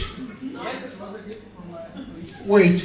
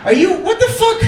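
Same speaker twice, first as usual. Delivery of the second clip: comic disbelief; 7 semitones higher than usual. Real, not acted. Comedian stunned by a suited man eating pistachios, high-pitched disbelief. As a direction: act stunned by the snack.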